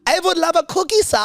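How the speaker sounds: high-pitched, put-on little boy voice; mock little-boy character. Teasing a friend as a little boy, high mock voice.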